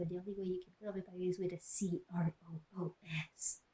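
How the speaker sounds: a quick, hushed whisper, spelling it out; fast whisper. Acted. Reader whispers the misspelled vampire word to the audience, fast. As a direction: hurry the spelling under your breath, almost unvoiced.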